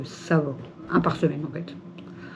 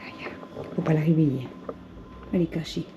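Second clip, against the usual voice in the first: hushed whisper; 4 semitones lower, 6 dB quieter, faster than usual. Real, not acted. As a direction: whisper so she stays asleep. Whispering near sleeping cat.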